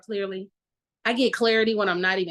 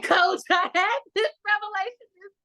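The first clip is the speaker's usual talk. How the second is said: much higher, laughing through "revelation"; real laughter breaking the line, pitched way up; 11 semitones higher than usual. Real, not acted.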